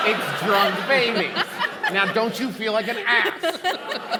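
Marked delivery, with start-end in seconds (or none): 0.1-1.4 mock triumphant. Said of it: "See, it's not halflings".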